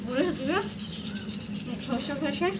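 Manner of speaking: talking with a toothbrush in mouth; words blurred by brushing teeth; while brushing teeth. Real, not acted. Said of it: "brush করতে করতে গল্প করছি".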